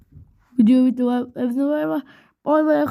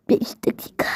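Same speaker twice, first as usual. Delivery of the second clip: whispered aside. Acted. Whispered after repeats.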